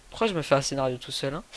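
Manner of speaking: ordinary talk, nothing marked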